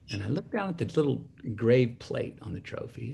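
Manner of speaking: usual speaking voice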